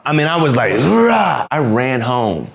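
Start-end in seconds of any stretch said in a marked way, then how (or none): 0.8-1.5 higher, hammed-up kid's war shout; mock battle cry. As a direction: let out a little fighter's roar.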